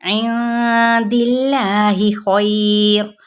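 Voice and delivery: इंदिल्लाहि slow, with a light lam; tajweed demo. Shown as a demonstration. Teacher showing students the light lam, slow demo. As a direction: stretch इंदिल्लाहि gently. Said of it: "बारीक पढ़ा जाएगा".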